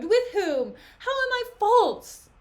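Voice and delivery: high, loud, incredulous questioning; acted outcry of wronged innocence; indignant protest. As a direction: challenge the accuser, pitch leaping up. Desdemona challenges the accusation.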